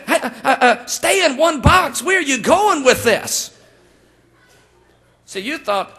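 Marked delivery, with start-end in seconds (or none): 0.1-3.5 high, loud stammering protest; hammed-up shout of a man losing the thread; exasperated husband. Husband begging his wife to stay on topic, exasperated.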